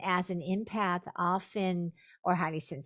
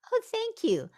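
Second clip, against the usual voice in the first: very high-pitched, louder polite thank-you; outwardly polite thanks; 5 dB louder than usual. Retold or imitated. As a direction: put on a high social voice to cover the excitement. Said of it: "on the outside, I was like".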